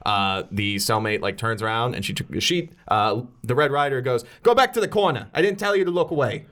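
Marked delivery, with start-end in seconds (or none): 4.4-6.4 raised, bossy Brooklyn-sounding order; botched Irish accent sliding toward Brooklyn. Acted. Prisoner ordering her cellmate back to the corner, Brooklyn-like accent. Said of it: "now she's from Brooklyn or wherever".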